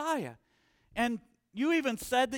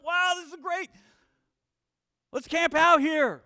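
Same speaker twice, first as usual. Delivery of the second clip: higher, louder delighted gush; thrilled disciples; 6 semitones higher, 8 dB louder than usual. Retold or imitated. Preacher voicing disciples thrilled on the mountain, excited. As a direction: sound like kids who never want to leave. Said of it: "Jesus is talking to them".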